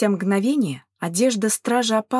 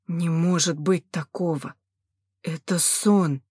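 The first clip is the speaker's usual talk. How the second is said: subdued, slowing moan of disbelief; groaning despair; 3 semitones lower, slower than usual. A narrator's reading. Nyubao wishing the nightmare away, mildly moaning. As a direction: let it sag into a mild groan. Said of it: "простонал Нюбао".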